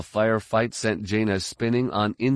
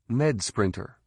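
ordinary delivery

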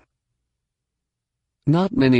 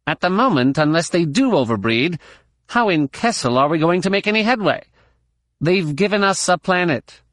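higher, exasperated alien scientist; Hodin doctor; 6 semitones higher than usual. A narrator's reading. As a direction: argue like a fed-up lab doctor. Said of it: "Doctor Plee, the Hodin".

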